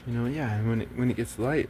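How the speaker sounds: normal talking voice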